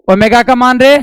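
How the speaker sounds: loud, sharp re-asking of a question; insistent demand. Real, not acted. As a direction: call it out loudly to the class. Teacher demands the answer louder.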